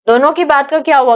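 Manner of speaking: ordinary delivery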